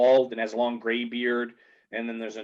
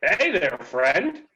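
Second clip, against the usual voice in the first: dwarves greeting; 7 semitones higher, 5 dB louder, much slower than usual. Acted. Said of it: "they all look up".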